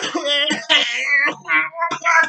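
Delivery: squeaky giggle, still in character; high mock giggle. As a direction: giggle like the whiner. Laughs in the mock voice.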